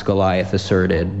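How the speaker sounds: ordinary delivery, nothing marked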